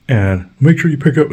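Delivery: high, loud nagging neighbor voice; mock neighbor. Neighbor's demand, acted.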